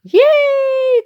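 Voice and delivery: high, loud squealed cheer; crowd cheering. Acted. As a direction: cheer like a delighted little crowd. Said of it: "The crowd cheered".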